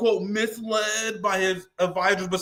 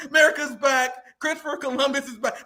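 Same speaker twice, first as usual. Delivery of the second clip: mock joyful sobbing; 4 semitones higher than usual. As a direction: fake tearful joy, cracking into laughs. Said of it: "maga accounts that be like".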